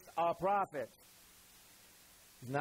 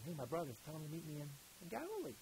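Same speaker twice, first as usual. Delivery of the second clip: gentle; 11 dB quieter, faster than usual. Retold or imitated. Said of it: "And yet he's like".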